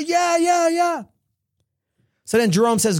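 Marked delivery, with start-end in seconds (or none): 0.0-1.0 high, shouted crowd yeah; excited shout. Acted. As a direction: cheer it back like a fired-up audience. Crowd answering the pitch.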